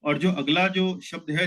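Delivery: ordinary talk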